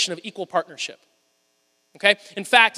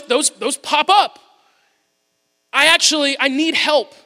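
ordinary talk

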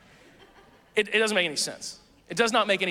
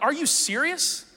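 slow, higher "are you serious"; mock disbelief; 7 semitones higher, slower than usual. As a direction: play the stunned diner. Customer reacting to missing dish.

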